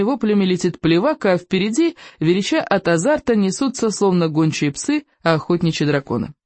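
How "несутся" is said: ordinary delivery, nothing marked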